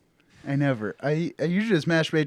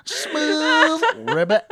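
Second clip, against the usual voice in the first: very high, slow croaky ribbit; mock croak; 14 semitones higher, 6 dB louder, much slower than usual. Teasing a cohost about a toad song, high croak. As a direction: stretch it out like a crooning toad.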